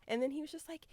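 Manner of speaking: normal talking voice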